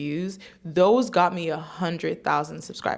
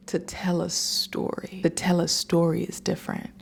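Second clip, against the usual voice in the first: hushed dramatic whisper. Pretend. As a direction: whisper it like a big secret.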